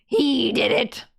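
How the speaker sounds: ordinary delivery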